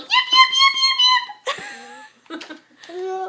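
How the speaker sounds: very high, shrieky yipping; squealed penguin imitation; penguin yips. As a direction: yip rapidly like an excited penguin. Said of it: "What does a penguin say?".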